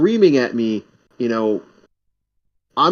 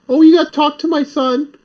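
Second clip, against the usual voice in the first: high, louder mock old lady; imitated older lady; 11 semitones higher, 5 dB louder than usual. Old lady refers apartment hunter to her son, high imitation. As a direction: put on a high old lady's voice. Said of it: "It was an older lady".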